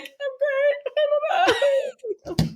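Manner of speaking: high wailing words, dragged out slow; retold tearful breakdown, half shouted; crying. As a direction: sob it out high and slow with a cry. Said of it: "I'm, like, crying".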